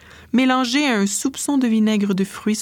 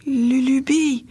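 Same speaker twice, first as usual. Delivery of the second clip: delirious calling; 6 semitones higher, slower than usual. Acted. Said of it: "répète-t-elle sans cesse".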